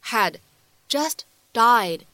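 "had just died" drawn out word by word, very slowly; slow deliberate read. Shown as a demonstration. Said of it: "来看一下末尾的这三个单词". (放慢逐词朗读)

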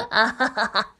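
nervous fake laugh; awkward laugh. Character laughs.

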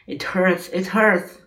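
high, pained outcry; acted cry of pain. A narrator's reading. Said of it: "he cried".

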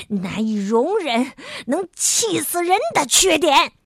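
loud exasperated shout. A narrator's reading. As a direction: blast it out, you've had enough. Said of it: "傲慢先生突然大声叫着说".

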